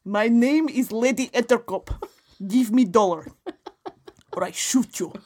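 hammed-up fake Russian accent; bad Russian accent. Lady Etterkop demands a dollar from comrades, bad Russian accent. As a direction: menace them in a phony Russian accent. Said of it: "maybe I should try Russian".

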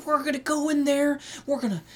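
high folksy Oklahoma accent, mocking; fake Oklahoma accent. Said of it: "fake Oklahoma accent that she does".

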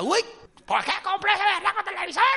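high, put-on voice of regret; hammed-up lament; wailing debtor. Believer in debt regretting the television, mock wailing.

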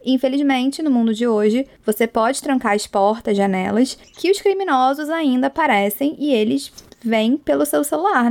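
normal talking voice

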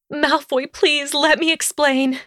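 high, desperate Hermione voice; high-pitched pleading outburst; blurted plea. A narrator's reading. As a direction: plead with him urgently, pitched well up. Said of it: "she blurted".

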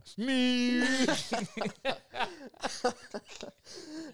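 long, high squealed "Mick" through closed-off mouth, breaking into laughter; imitated underwater yell. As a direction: mimic calling a name underwater. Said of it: "one name you can say underwater".

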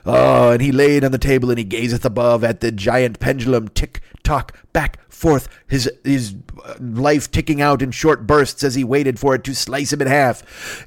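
slow, grandiose storyteller voice, dragging out the tick tock; mock Poe narration. Edgar Allan Poe telling his story, slow and grand. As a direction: ham up a gothic storyteller. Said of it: "and he's like".

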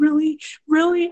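high squealed really; imitating a thrilled bride; excited disbelief. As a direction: squeal with surprise. Bride delighted at offer.

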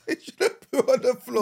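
high laughing protest. Real, not acted. Husband laughing at wife's baby worry, laughing high. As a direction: laugh the words out in disbelief.

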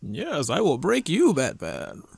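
imitating Tom Hardy's Bane; mock muffled villain threat. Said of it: "The Bane voice".

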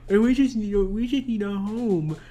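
hammed-up plea in a put-on voice; mock movie character. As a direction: mimic the character, exaggerating the plea. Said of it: "I don't think he's like".

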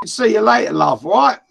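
loud, quick cheeky Londoner send-off; mock London geezer goodbye; a hint of a cockney farewell; London accent. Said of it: "Northern London, no".

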